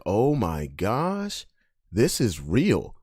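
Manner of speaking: slow, stunned amazement; slow awed hush; awestruck. Retold or imitated. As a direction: drag it out in disbelief. Awestruck at first MMA fight.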